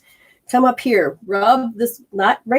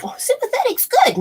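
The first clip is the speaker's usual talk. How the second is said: mock naive listener. Mocking a misinformed listener.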